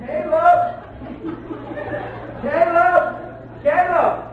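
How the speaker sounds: put-on high hollering of a lost child's name; search party calling. Men with flashlights searching for the baby, high hollering. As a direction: holler it into the woods. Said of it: "flashlights going".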